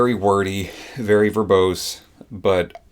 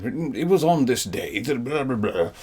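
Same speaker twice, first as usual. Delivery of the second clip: put-on posh English accent; 5 semitones higher than usual. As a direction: read it like a posh English gentleman. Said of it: "a posh Englishman voice".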